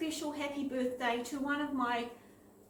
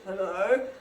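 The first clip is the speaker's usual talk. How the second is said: put-on low, slow growly old woman; deep growly voice; 6 semitones lower, 6 dB louder than usual. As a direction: growl it like a stern stranger. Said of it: "this deep, growly voice said".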